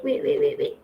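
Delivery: whispered wait, wait, wait under her breath; hushed repeated wait. Real, not acted. Teacher stalling her online class in a whisper. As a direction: drop to a near whisper while stalling.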